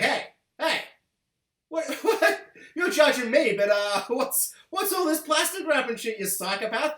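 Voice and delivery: high, laughing, incredulous jab; mocking Kurt. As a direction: laugh through it like a killer calling out a hypocrite. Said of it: "Kurt's like".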